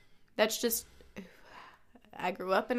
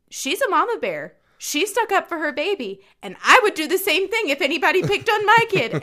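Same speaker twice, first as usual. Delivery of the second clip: lightly raised, emphatic quote; quoting a friend; 7 semitones higher, 7 dB louder than usual. Retold or imitated. Friend defending a mother to her mom, rising emphasis. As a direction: defend her with growing conviction. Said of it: "Deidre told her mom".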